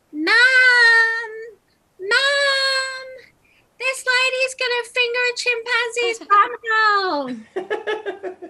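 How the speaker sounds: put-on piercing, very high kid calling out for Mum; high-pitched child's voice. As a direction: shout it loud in a high child's squeal, stretching each "Mum". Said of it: "this really high-pitched voice".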